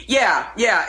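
ordinary delivery

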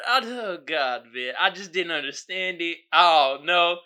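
ordinary talk, nothing marked

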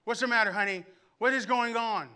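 dad asking his screaming daughter what happened; alarmed parent. Retold or imitated. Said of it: "We go".

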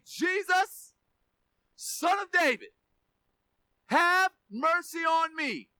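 drawn-out plea shouted to someone passing; crying out. Acted. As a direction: call out for mercy, slowing on the last plea. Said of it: "he began to cry out and say".

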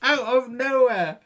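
high laughing reply; giggling through it. Real, not acted. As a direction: laugh the words out. Laughing reply about the cat.

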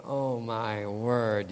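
slow, dazed mock disbelief; drawn-out, dumbfounded aside; stunned. Husband hearing the greenhouse plan, slow stunned disbelief.

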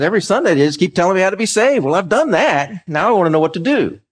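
friend venting his frustration; fed-up friend. Retold or imitated. Friend complaining about his church.

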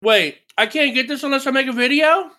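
high, loud protesting question; indignant disbelief. Real, not acted. Gamer learns the emblem needs a video, indignant disbelief.

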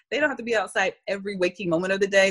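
ordinary talk, nothing marked

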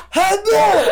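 huge high sneeze shouted like "hello"; loud sneeze. Shown as a demonstration. Showing friends own very loud sneeze, shouted. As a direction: let out a mega-loud, high-pitched sneeze. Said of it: "And I go, I go".

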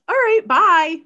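high, loud, cheerful brush-off; breezy goodbye. Retold or imitated. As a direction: chirp a careless bye. Andersons' breezy exit.

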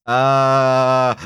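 long droning imitated alarm groan; Tina Belcher. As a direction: hold one steady "uh" like a human alarm. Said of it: "the alarm is a guy going".

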